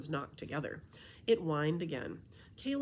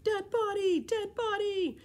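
screamed much higher and louder in panic; 7 dB louder than usual. Acted. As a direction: shriek the alarm. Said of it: "Emily screamed".

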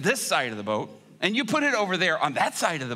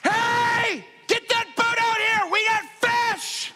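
excited shout; 4 dB louder than usual. Acted. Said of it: "And he's like".

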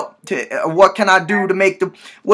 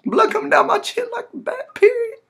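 much higher, strained whiny voice; high pitched comic whimper; high whine; 4 dB quieter than usual. Acted. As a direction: squeak it out like a hurt kid. Prank caller whining about bleeding.

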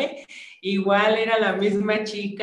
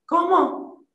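high, sharp disbelieving what?; shocked what; baffled; 10 semitones higher, 4 dB louder than usual. Retold or imitated. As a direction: squeak out your confusion. Friends baffled by the reply.

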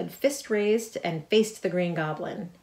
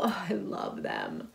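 breathy adoring whisper; starstruck whisper; 5 dB quieter, slower than usual. A narrator's reading. MJ seeing the Marvels, a starstruck whisper.

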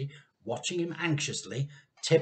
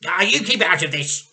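loud snapping retort; irritated retort; 4 semitones higher, 9 dB louder than usual. A narrator's reading. Mr Cranky rebuffing his wife, loud irritated retort. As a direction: brush her off. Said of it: "cried Mister Cranky".

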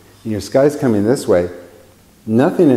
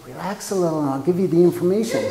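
higher, softer, quick coaxing; soothing inner voice; 6 semitones higher, 5 dB quieter, faster than usual. Retold or imitated. The right brain calming the left brain, soft and coaxing. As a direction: gently talk the panicked mind down.

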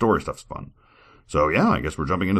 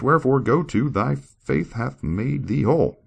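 higher, louder scripture reading; 7 semitones higher, 5 dB louder than usual. Acted. Reader voicing Enos's joy at forgiveness, proclaiming. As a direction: read it out high and loud, proclaiming.

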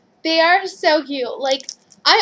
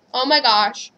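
usual speaking voice

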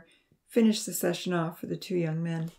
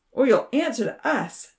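threatening; 7 semitones higher, 5 dB louder than usual. A narrator's reading. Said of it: "Charlie replied".

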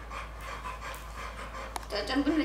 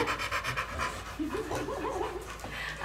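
soft high breathy giggle; breathy laugh; 9 semitones higher, 9 dB quieter than usual. Real, not acted. Owner amused by her barking dog, soft giggle.

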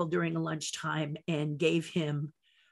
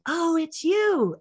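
relieved Michael; 8 semitones higher, much slower than usual. Retold or imitated. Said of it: "he started laughing".

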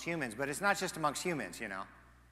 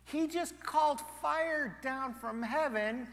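rising amazement; 8 semitones higher, 6 dB louder, slower than usual. Acted. Listing Elijah's feats in amazement.

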